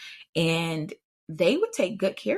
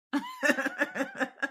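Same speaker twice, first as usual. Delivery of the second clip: a quick, much higher burst of laughter; 5 dB quieter than usual. Real, not acted. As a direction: laugh it off in amused disbelief. Laugh after imagined "Huh?.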